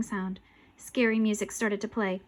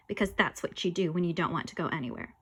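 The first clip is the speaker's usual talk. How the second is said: usual speaking voice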